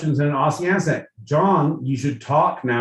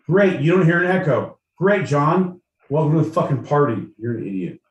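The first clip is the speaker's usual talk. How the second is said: raised-pitch mock congratulations; sarcastic exasperation; 2 semitones higher than usual. Real, not acted. Co-host mocks John's echo troubleshooting, sarcastic. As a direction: congratulate him with biting sarcasm.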